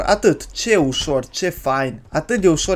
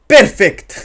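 loud, raised-pitch exclamation; triumphant; 4 semitones higher, 8 dB louder than usual. Real, not acted. Player finishing the sandwich, loud triumphant announcement. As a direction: announce it loudly like a finished masterpiece.

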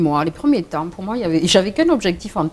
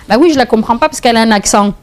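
imitating her daughter; 6 semitones higher, 10 dB louder, faster than usual. Mother voices sulking daughter's reply.

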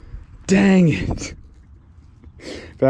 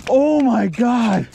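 high and slow, full of wonder; awed gasp; 9 semitones higher, 3 dB louder, slower than usual. Real, not acted. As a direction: let the awe stretch out. Marvelling at the fish.

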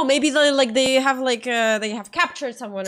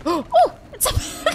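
surprised gasp. Real, not acted. Gasp then laugh.

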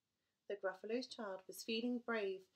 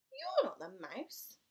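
put-on slow child accusation; 4 semitones higher, 8 dB louder, slower than usual. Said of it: "child speaks to snake".

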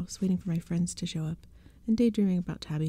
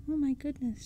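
high-pitched amazed exclamation; exclaiming; 7 semitones higher than usual. A narrator's reading. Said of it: "she exclaimed".

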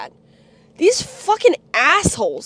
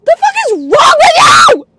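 very high, loud, crying shout; tearful scream; 10 semitones higher, 5 dB louder than usual. Real, not acted. Confronting family who never reached out, tearful scream. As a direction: scream it on the edge of sobbing. Breaking point in family rant.

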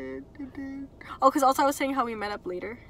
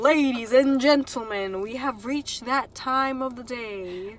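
slow, grand announcer; slower than usual. Pretend. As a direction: ladies and gentlemen, drawn out. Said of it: "You sound like an announcer".

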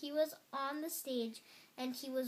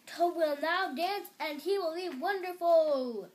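loud, higher showman's announcement; strange announcer voice; 5 semitones higher, 9 dB louder than usual. A narrator's reading. Voice announcing Toad's dance, loud grand proclamation. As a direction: proclaim it to the whole theater. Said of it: "said the voice".

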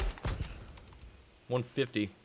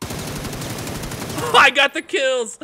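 slow, high, laughing brag; excited laughing cheer; 7 dB louder, much slower than usual. Real, not acted. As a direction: savor the win, drawn out and high. Celebrates kills laughing.

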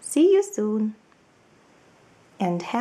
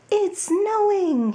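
higher, slow, drawn-out joyful cry; excited cry; 11 semitones higher, 4 dB louder, slower than usual. Acted. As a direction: Mole shouts his delight at the snow. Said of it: "he cried".

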